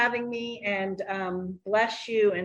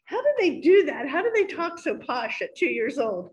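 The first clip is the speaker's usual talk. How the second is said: amused amazement; 7 semitones higher, 4 dB louder than usual. Retold or imitated. Amazed at toddler's posh talk.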